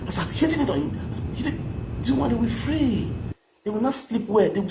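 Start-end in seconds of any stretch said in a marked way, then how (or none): 1.0-2.0 whisper. Real, not acted. Whispered aside.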